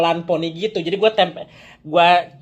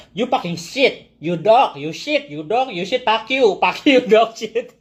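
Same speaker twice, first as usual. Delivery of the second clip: mock clumsy insults; 4 semitones higher, slower than usual. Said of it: "terus kalian mau abuse gimana?".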